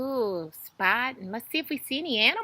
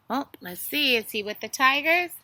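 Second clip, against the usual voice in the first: ordinary talk, nothing marked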